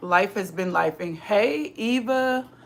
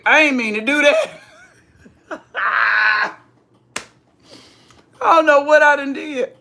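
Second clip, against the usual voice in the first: laughing; 7 semitones higher, 7 dB louder than usual. Real, not acted. Green screen mistake, laughing.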